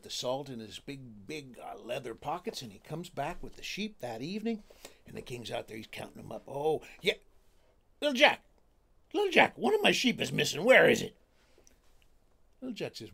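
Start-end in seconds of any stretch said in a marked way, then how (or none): 6.5-11.2 angry king. Acted. King finds sheep missing.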